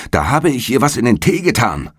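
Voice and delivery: high-pitched call, climbing towards the final question. A narrator's reading. Young man to the departing gentleman, calling out a confession. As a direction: call it after him, voice pitched right up. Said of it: "Der junge Mann ruft".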